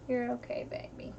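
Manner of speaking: hushed, gentle reassurance; soft soothing whisper. Real, not acted. Mom soothing hurt son.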